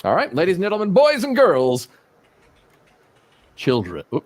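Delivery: grand hammed-up announcer oration; pompous senator oratory. Mock grand oration plugging sites.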